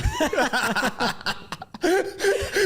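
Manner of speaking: high laughing burst; high burst of laughter. Real, not acted. Laughing at headline joke.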